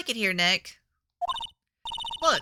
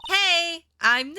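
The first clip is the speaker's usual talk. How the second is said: slightly raised, louder hello; louder greeting; 6 semitones higher, slower than usual. Acted. Testing whether a camera reacts to voices, calling out louder. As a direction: call it out a bit harder. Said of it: "Maybe I'm not saying it loud enough".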